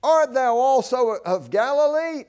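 loud, high, scornful question; acted incredulous put-down; disparaging. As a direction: fling it out as a jeering challenge. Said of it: "that's the sense of this".

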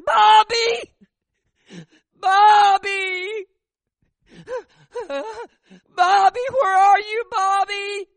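very high, loud crying calls; desperate calling. Retold or imitated. Grieving mother on the mountainside calling for her dead son, crying out. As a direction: wail for the lost son across the mountain. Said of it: "she was saying".

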